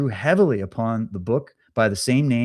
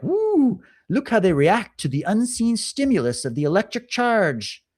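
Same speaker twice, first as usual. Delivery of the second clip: high-pitched whoop into delighted marvelling; mock gleeful excitement; 8 semitones higher than usual. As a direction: play a thrilled experimenter watching the rats. Acting gleeful watchers of lab rats.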